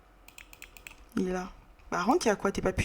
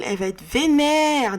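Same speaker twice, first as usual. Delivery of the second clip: higher, drawn-out exclamation; exclamation amusée; 5 semitones higher, 4 dB louder than usual. Real, not acted. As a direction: stretch it out in mock alarm. Commente la colère qui arrive. (exclamation traînante, faussement alarmée)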